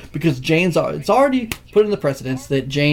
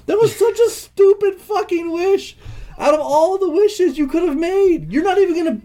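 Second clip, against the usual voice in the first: exasperated rant; 12 semitones higher than usual. Real, not acted. Ranting at a movie's ending.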